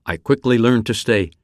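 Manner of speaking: plain reading voice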